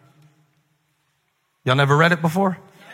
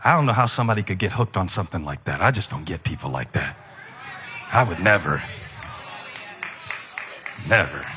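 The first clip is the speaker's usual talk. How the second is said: low, softer, smug self-righteous voice; smug churchgoer; 7 semitones lower, 6 dB quieter, faster than usual. Pretend. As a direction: play the pious know-it-all, softly. Continuing smug churchgoer impression.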